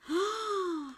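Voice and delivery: high, drawn gasp of delight; amazed gasp. Real, not acted. Seeing a handmade gift up close, amazed gasp.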